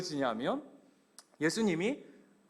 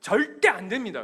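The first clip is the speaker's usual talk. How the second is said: firm protest; 3 semitones higher, 7 dB louder than usual. Acted. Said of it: "꾸짖으면서".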